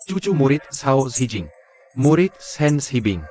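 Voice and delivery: very slow, drawn-out naming; slow mocking recital. Old fighter sneers at the rival sect's disciples, very slow. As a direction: spell out the lineage slowly in scorn.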